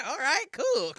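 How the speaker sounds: high laugh running into the words; laugh, then talk through it, pleased; pleased laughter. Real, not acted. Laughs after celebrating his answer.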